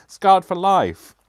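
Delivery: high, loud, drawn-out mock shout; hammed-up heckler's yell; imitated shouting. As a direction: call it out slowly as a jeering crowd. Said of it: "they shout from the overlap".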